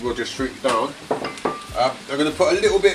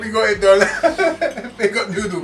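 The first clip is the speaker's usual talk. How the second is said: laughing; 8 semitones higher, 5 dB louder, slower than usual. Real, not acted. Laughing celebration.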